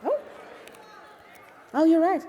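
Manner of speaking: sudden high, loud realization; surprised. Real, not acted. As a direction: caught out, blurting it. Realizes the winner was announced early.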